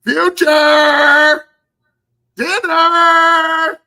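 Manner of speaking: long, high falsetto holler; mock dinner call. Mother calling her child to macaroni and cheese, hammed-up yell. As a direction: stretch each word into a shrieked summons. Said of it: "macaroni and cheese time and she goes".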